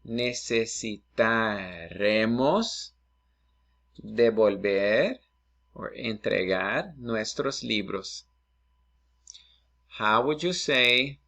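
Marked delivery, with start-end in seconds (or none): 0.0-8.2 slow model phrase. Shown as a demonstration. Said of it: "So we will need".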